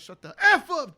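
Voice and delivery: sudden loud, high yell of "Shut the F up"; loud outburst. Retold or imitated. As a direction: blurt it out way too loud. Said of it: "'cause they're in an auditorium, he goes".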